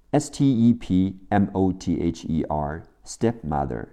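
ordinary talk

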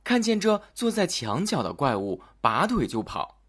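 usual speaking voice

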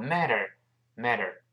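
"matter" said quickly with a softened t; quick, light flapped t in "matter". Shown as a demonstration. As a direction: run "matter" together as "madder". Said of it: "读快之后matter".

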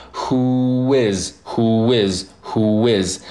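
"Who is" slowly glided together, hoo-wiz; slow linking demo of "Who is"; linked words. Shown as a demonstration. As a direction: stretch "Who is" and link it with a w glide. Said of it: "who和is可以进行连读".

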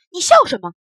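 sharp high shout; loud demand. Acted. Bully shouts at the tumbler doll, loud and high. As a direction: bark the question at the laughing toy. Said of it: "大声说".

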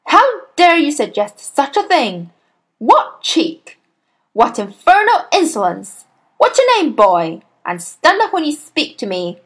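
loud, high, indignant headmistress; outraged scolding. A narrator's reading. Headmistress demands the cheeky boy's name, outraged. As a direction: tear into the impudent child, loudly.

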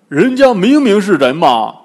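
high, loud contradiction; protesting Bajie. Acted. Bajie contradicts Wukong before their master, protesting loudly. 八戒反驳悟空.

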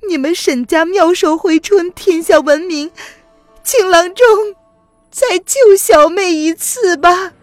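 high, loud, crying entreaty; tearful plea. Acted. Woman tearfully begging the physician again, high and crying. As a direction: plead through tears. Song begs, weeping.

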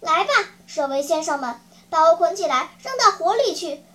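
ringing, quick heroic declaration; stirring speech of self-sacrifice; bold and impassioned. Acted. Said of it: "声调豪迈激昂".

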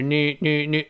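"new" quickly, with a faint y; light glide. Shown as a demonstration. Teacher gives the correct sound, light-glide "new". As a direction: keep just a trace of y after n in "new". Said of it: "是new，new，new这么一个声音啊".

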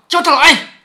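loud, high, angry order; sharp rise in volume and pitch, enraged; furious command. Acted. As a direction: summon him in a flash of temper. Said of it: "这里凤姐一定是很生气、很愤怒的嘛".

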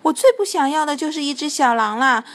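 loud, high startled refusal; alarmed cry. A narrator's reading. Witch seeing the little wolf, alarmed cry. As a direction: cry out in alarm, jumping up in pitch. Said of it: "惊呼道".

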